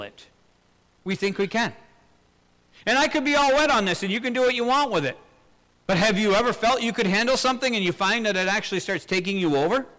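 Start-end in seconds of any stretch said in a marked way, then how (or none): 1.0-9.9 much higher and louder, faster, pressing the point; fervent preaching. Acted. Preacher challenging his listeners, fervent and loud. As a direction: lean into the congregation, urgent.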